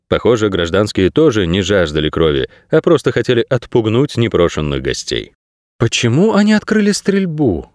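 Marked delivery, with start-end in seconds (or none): 5.8-7.7 put-on high, musing young girl voice; thoughtful girl's voice. A narrator's reading. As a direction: wonder aloud as a young girl. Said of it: "задумчиво спросила Кей".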